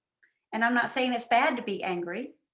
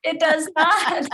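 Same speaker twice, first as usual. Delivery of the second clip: laughing protest; 5 semitones higher, 6 dB louder, slower than usual. Real, not acted. Teased, protests while laughing.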